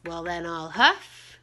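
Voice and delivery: slow, building wolf threat, loud on blow; wolf threatening the little pig; big bad wolf. A narrator's reading. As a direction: stretch it out and swell to a loud blow. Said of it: "And so the wolf says".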